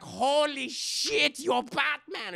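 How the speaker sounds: put-on high, drawn-out astonished German accent; loud mock amazement in a German accent; hammy German doctor gobsmacked; Hugo Strange. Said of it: "Doctor Hugo Strange is like".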